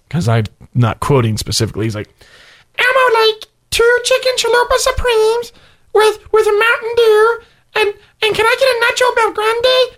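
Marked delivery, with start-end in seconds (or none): 2.8-9.9 very high, squeaky Elmo ordering food. Retold or imitated. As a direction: order tacos as a cheery Elmo. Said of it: "the Elmo voice".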